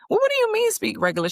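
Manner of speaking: very high, fast mock-baffled question; mock puzzled. Mother pretending not to understand her daughter, very high mock-baffled voice. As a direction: play dumb with a big surprised lift.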